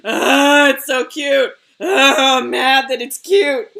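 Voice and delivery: loud frustrated groan into a gripe; mock angry. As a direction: yell it in exasperation at the cuteness. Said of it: "I just go angrily searching".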